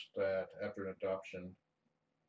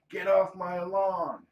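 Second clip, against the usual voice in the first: high, drawn-out mock yell; imagined yelling; 11 semitones higher, 14 dB louder, slower than usual. Cat owner imagines her cat as a yelling human, high mock shout. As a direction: holler it slowly and very loud, in play. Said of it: "I can picture her yelling".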